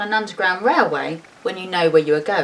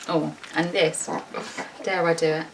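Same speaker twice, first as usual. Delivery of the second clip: plain reading voice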